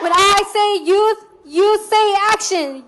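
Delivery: loud pumped crowd instruction; hyped crowd warm up. Real, not acted. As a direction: hype the crowd, loud and high. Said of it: "call and response chant".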